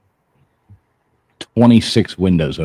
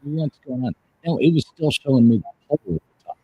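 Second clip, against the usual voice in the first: underwater sound; 7 semitones higher, faster than usual. Real, not acted. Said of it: "Why do you sound funny?".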